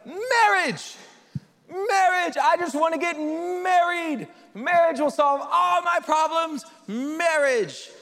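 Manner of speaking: high, drawn-out, hammed-up yearning; exaggerated dreamy excitement; mock starry-eyed longing. Mimicking singles desperate to get married.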